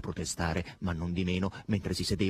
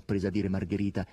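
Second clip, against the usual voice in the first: usual speaking voice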